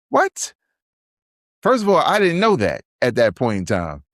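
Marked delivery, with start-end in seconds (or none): 0.1-0.5 very high questioning what; startled and confused; shocked what. Retold or imitated. Friends hearing the sickle cell line: very high shocked question. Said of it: "we was like".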